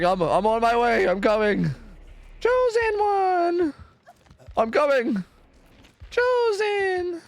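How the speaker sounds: high, drawn-out mock calling to the chosen one; hammed-up pleading crawl; mock survivor voice.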